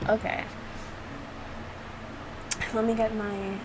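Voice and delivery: ordinary delivery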